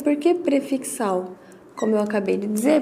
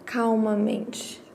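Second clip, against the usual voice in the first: slow and calm; 2 semitones lower, 7 dB quieter, much slower than usual. Shown as a demonstration. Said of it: "Porque você está falando".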